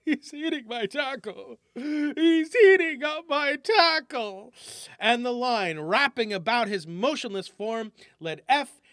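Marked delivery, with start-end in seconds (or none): 0.0-1.6 sad mumble. Acted. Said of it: "mumble sadly to himself". 1.7-4.5 despairing cry. Acted. Jones despairs, nerve gone.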